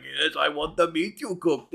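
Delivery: low, soft ogre voice; dim ogre. Acted. Goblin imitates the ogre accepting cooked meat, dim ogre. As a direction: play a gullible ogre, low and quiet. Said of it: "That is a good impression".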